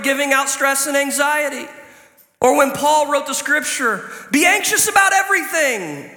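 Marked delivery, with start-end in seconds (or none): 4.3-6.1 mock misquote. Shown as a demonstration. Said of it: "That's not what it says".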